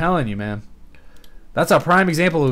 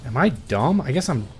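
normal talking voice

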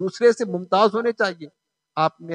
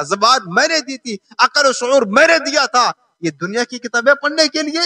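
raised, accusing sermon voice; full-force preaching; 5 semitones higher, 4 dB louder than usual. Real, not acted. Preacher voicing God's questions on Judgement Day, forceful sermon. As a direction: confront the congregation with insistent force.

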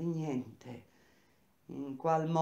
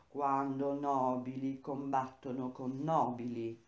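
plain reading voice

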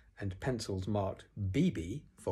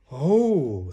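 loud, long, raised "oh"; awed; 5 semitones higher, 11 dB louder than usual. A narrator's reading. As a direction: marvel at a wonderful gift. Pooh awed by his present.

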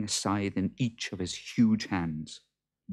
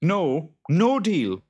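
high, slow worried farmer; Jude's voice; 10 semitones higher, 8 dB louder, slower than usual. Acted. Jude asking his wife what to do, high and slow. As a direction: ask it loud and fretful.

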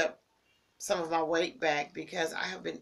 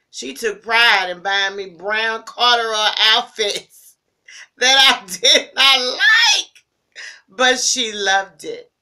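laughing fondly; 4 semitones higher, 16 dB louder, slower than usual. Real, not acted. Laughing recalling mother's corduroy outfits.

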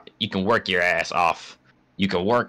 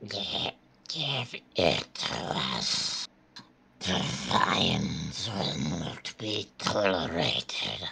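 slow, soft, rasping creature voice; creature whisper; 7 dB quieter, slower than usual. Pretend. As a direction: hiss it like an eerie beast. Voicing game creatures.

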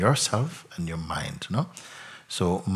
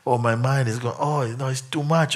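lightly higher, hammed-up moan of too much; mock overwhelm; 5 semitones higher than usual. Teacher mocking a dramatic complaint, mild hammed-up moan. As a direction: parody someone wailing it's too much. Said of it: "Would you be saying".

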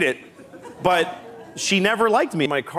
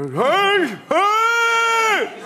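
much higher, louder shouts with an echoing ring; reverb shout; 3 dB louder than usual. Shown as a demonstration. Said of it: "Can you do it with the reverb?".

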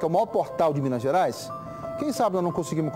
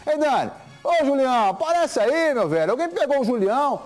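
loud, high rallying appeal; rallying call; 7 semitones higher, 4 dB louder than usual. Real, not acted. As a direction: fire up the audience. Host rallies viewers, calling out.

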